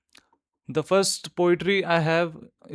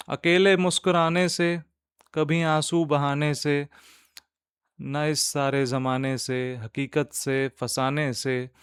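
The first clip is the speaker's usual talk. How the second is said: nazm recitation; 4 dB louder than usual. Acted. Host reciting a nazm to podcast listeners, measured poetic recitation. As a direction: recite line by line, lifting the verses and letting the refrain fall.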